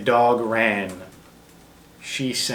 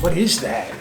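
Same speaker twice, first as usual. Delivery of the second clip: startled; 5 semitones higher, 4 dB louder than usual. Real, not acted. Startled by the alarm.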